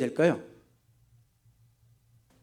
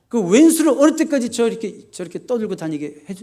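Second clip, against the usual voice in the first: loud, raised-pitch protest, easing off; angry complaint; 6 semitones higher, 7 dB louder than usual. Acted. David protesting to God about his tormentor, resentful. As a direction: burst out in exasperation, then ease back. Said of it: "그 사람에게 분노하고 하나님께 원망하는".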